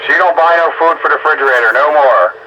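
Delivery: normal talking voice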